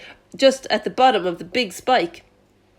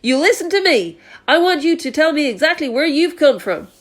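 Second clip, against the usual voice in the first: higher, louder stern official; police chief calling up; 6 semitones higher, 3 dB louder than usual. Acted. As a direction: demand answers from the roof.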